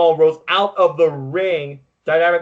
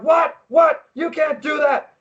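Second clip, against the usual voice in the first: high shouted "what? what?"; outraged disbelief; 10 semitones higher, slower than usual. Retold or imitated. Fan reacting to the DQ finish, high outraged protest. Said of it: "It was like".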